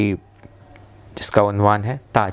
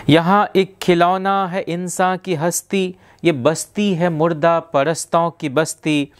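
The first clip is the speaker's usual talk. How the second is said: measured Urdu verse recital, raised pitch sinking at each refrain; poetry recitation; 5 semitones higher than usual. Acted. Reciting a favourite Sahir lyric from Pyaasa, measured poetic declamation. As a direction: declaim each stanza high, then let the refrain fall.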